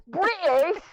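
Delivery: high, rising mock-British yelp; high-pitched meme impression. Echoing a friend's British meme, high-pitched mimicry. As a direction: echo the meme, squeaking up at the end. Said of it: "so high pitched at the end".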